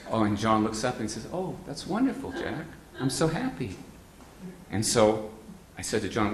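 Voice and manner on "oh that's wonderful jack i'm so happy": high and soft, kindly pleased; a soft, fond congratulation; gentle pleased reply. Retold or imitated. John congratulating Jack, soft and pleased.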